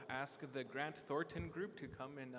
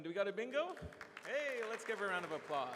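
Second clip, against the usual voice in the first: louder, higher hype call; excited; 7 semitones higher, 6 dB louder than usual. Real, not acted. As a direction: pump up the crowd. Winner found, hyping the room.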